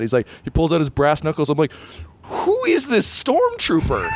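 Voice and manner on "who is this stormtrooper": high, drawn-out cry of disbelief; incredulous outburst. Acted. Player to game master about an unbeatable trooper, incredulous outburst. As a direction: demand an answer in a stretched, high-pitched voice.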